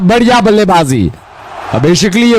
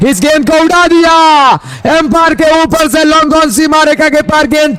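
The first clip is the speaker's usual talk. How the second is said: excited cricket commentary; 6 semitones higher than usual. Real, not acted. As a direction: shout the six over the umpire. Another six called live. (छक्के पर ऊँची जोशीली commentary)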